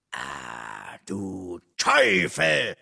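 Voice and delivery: low slow groan swelling into a yell; wütender Schrei. A narrator's reading. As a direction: draw it out, then explode. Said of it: "schrie Örök". (langsam anschwellender Wutschrei)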